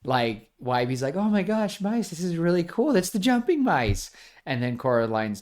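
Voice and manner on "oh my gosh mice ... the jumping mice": put-on high, excited kid gushing; excited Wybie. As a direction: gush like a thrilled boy. Wybie excited about the mice.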